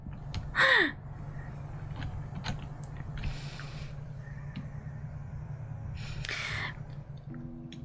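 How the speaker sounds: breathy unvoiced giggle fit; long wheezy laugh. Real, not acted. Laughing at BL joke.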